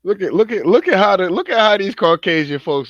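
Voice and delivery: excited outburst. Real, not acted.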